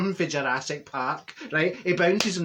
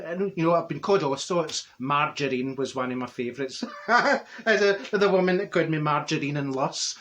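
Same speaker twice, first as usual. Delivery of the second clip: ordinary delivery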